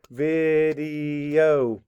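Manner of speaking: VIDEO drawn out in three separate beats; syllable by syllable. Shown as a demonstration. Teacher showing how to clap video, syllable by syllable. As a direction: say video slowly, one stretched syllable at a time. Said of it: "if you were to clap video".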